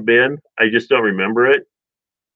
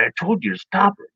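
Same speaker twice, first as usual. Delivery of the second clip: sister's scold; 7 semitones higher, 4 dB quieter than usual. Retold or imitated. Sister scolding in back seat.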